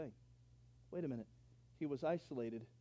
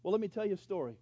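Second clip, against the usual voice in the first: ordinary delivery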